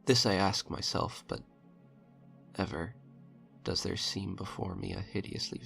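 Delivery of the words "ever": ordinary delivery, nothing marked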